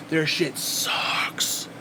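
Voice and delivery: slow, drawn-out whisper; disgusted whisper. Real, not acted. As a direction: whisper it slowly with contempt. Disgusted verdict ending a rant.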